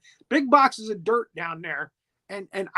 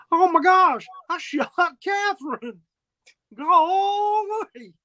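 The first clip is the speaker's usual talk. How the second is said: higher, hammed-up wail that breaks into a fake sob; mock anguish; 9 semitones higher, 3 dB louder, slower than usual. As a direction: play a shocked man crying, overdone. Frank shot his fiancee.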